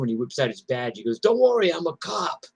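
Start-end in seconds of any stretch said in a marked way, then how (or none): 1.2-2.4 high, loud, then easing off; cocky cop. Retold or imitated. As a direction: reassure the driver with a grin. Cop reassuring the cab driver.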